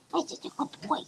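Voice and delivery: soft voiceless praise for the dog; whispered praise. Real, not acted.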